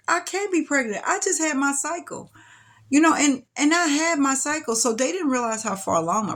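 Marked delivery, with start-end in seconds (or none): 0.1-2.3 high, protesting disbelief. Retold or imitated. Patient refusing the nurse's diagnosis, high disbelief. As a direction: push back on the news, pitched up.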